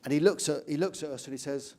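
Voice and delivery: ordinary talk, nothing marked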